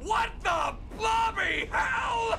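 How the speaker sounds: high, slow outraged yell; shocked shout. Real, not acted. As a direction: yell it out in disbelief. Shocked by burning nightmare.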